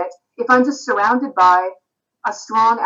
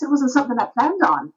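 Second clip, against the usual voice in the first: slipping into a light Irish accent, pitched higher; 5 semitones higher than usual. Shown as a demonstration. Telling the host about tea with an Irish friend, in a light Irish accent. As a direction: let an Irish lilt creep in. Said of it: "speaking like this".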